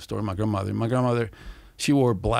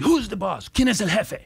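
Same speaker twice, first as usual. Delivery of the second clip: tough mom; 9 semitones higher than usual. Retold or imitated. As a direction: demand it, daring them. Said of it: "and then she".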